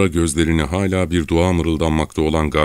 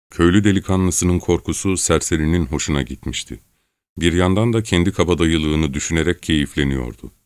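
plain reading voice

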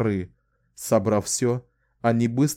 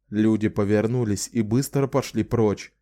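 ordinary delivery, nothing marked

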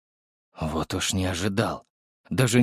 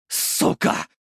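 voiceless, venomous curse; angry hiss. A narrator's reading. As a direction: hiss it under your breath. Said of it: "прошипел жандарм". (злобное шипение)

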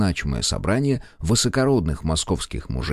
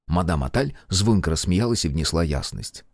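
ordinary delivery

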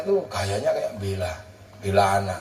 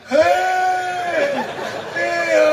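high, loud startled cry; startled shout; 13 semitones higher, 3 dB louder than usual. Retold or imitated. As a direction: jump at the sudden surprise. (teriak kaget)

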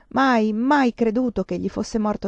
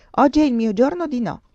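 higher, louder, lightly voiced little boy; grave child; 5 semitones higher, 5 dB louder than usual. A narrator's reading. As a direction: declare it with childish solemnity. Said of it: "fece Tioca gravemente". (solenne, da bambino)

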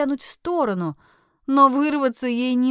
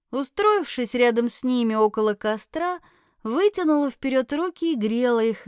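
ordinary delivery, nothing marked

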